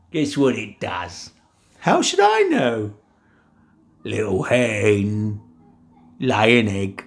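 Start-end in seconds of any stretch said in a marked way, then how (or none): 1.8-2.9 high, squeaky giantess voice; a shrill high-pitched wife. Acted. 4.0-7.0 low, slow giant voice; slow giant command. Acted.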